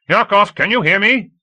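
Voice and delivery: high, loud worried shout; anxious shout into the microphone; loud anxious call. A narrator's reading. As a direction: call out fearing no answer. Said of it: "Komov asked loudly and anxiously".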